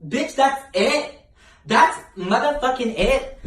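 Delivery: high, slow incredulous squeal; mock disbelief. She scoffs at the easy line test, high and slow. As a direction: stretch it out in disbelief. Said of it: "And I'm like".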